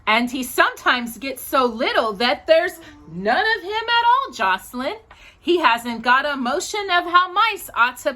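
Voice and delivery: put-on sing-song poem reading for children, lilting; reading a poem aloud. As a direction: read it like a bedtime poem to little kids.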